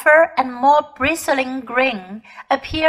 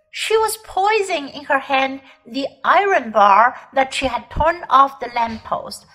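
ordinary delivery, nothing marked

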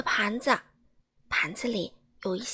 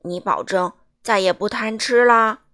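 stern giant; 3 semitones lower, 9 dB louder than usual. A narrator's reading. Giant scolding Mr Greedy.

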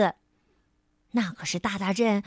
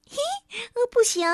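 cheeky elf; 10 semitones higher, much faster than usual. Acted.

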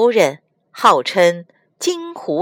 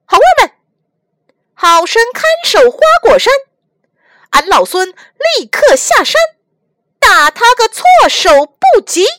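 commanding shout; 8 dB louder than usual. Acted. Monkey commands his monkey army.

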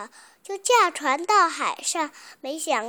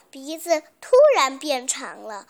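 ordinary delivery, nothing marked

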